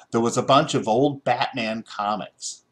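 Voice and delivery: ordinary delivery, nothing marked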